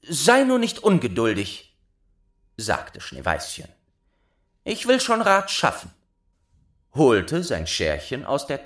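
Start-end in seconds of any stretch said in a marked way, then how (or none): 0.0-1.8 put-on higher, louder girl's voice; pacify him in a high girlish voice; soothing young girl. Schneeweißchen calms the impatient dwarf, high and girlish. 4.6-5.9 put-on very high girl's voice; helpful young girl. Schneeweißchen promises the dwarf a solution, high and girlish.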